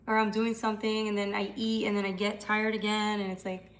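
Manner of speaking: usual speaking voice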